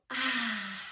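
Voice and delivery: soft, low breathed-out "ah"; calming exhale. Shown as a demonstration. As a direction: let the breath go gently. Models breathing out in story.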